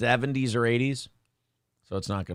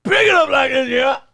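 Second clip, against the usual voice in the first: shouting; 8 semitones higher, 5 dB louder than usual. Retold or imitated. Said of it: "and I go".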